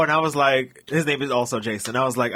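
ordinary talk, nothing marked

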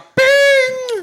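very high, ringing vocal "ping"; imitated bell ding. Standing in for the missing bell, high sung ding. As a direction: mimic a bell with a long high note.

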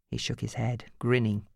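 usual speaking voice

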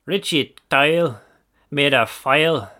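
slow, droning Northern Irish commentator; mock Northern Irish commentary; Nordy accent. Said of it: "Every time I do the Nordy accent".

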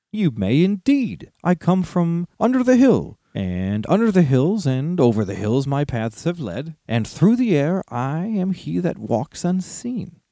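proud little hobbit, riddling. Acted. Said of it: "went on Bilbo".

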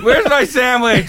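slow high whiny yell; yelling across the room. Retold or imitated. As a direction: drag out a spoiled whine to Mom. Imitating movie character calling mom.

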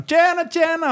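high-pitched mock-cheery hello; acted greeting. Driver barging in to ask for a light, high acted greeting. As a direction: call it out high, retelling your entrance.

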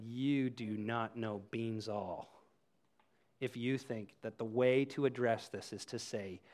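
low, quiet, deliberate reproof; retold rebuke. Speaker confronting the person who downplayed it, low rebuke. Retold stern reply to the offender.

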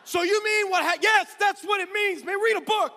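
shouted embarrassing question; loud awkward exclaim. Retold or imitated. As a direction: blurt it across the restaurant. Bobby loudly asking in restaurant.

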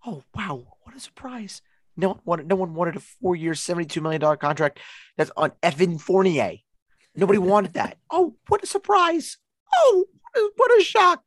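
high, cracking mock shock; sarcastic surprise. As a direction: pretend to be stunned, dripping sarcasm. Sarcastic mock surprise over contract.

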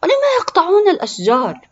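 put-on high, loud, indignant child; angry. A narrator's reading. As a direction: demand an answer in a boy's outrage. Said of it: "قال بغضب". (غضب طفل بصوت عالٍ)

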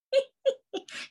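high burst of laughter; giggling fit; high squealing laugh. Real, not acted.

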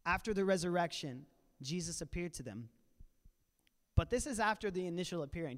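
ordinary talk

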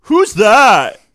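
loud, high, drawn-out mock yelling; rowdy teenagers. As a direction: imitate teens hollering in a cinema. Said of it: "there were the rowdiest group of teenagers".

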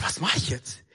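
low, whispery worried aside; hushed self-talk. Retold or imitated. Struggling swimmer asking himself what to do, hushed self-talk. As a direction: ask yourself under your breath.

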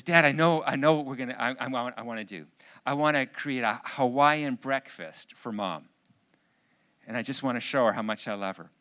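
loud, eager announcement; excited daughter. Retold or imitated. Daughter tells dad her surprise for mom, excited. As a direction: imitate a child bursting with a plan. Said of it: "super excited".

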